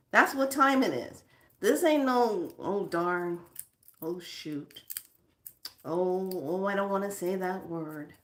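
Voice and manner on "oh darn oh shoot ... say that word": mock meek reluctance. Mocks mealy-mouthed polite swearing.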